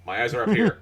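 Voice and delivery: high indignant mock protest; mock scolding people staring at the jeans; mock offended. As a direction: scold the starers playfully. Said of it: "It's like".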